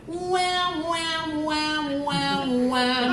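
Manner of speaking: wham, sung high and twangy, stepping down; nasal forward bratty wham. Shown as a demonstration. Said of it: "a bratty little twangy sound like that by going".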